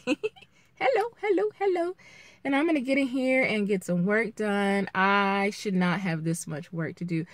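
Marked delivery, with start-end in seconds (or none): 0.0-2.0 high squeaky hello, laughing through it; giddy high-pitched hellos; playful greeting. Real, not acted. Vlogger popping on to say hi, playful high voice.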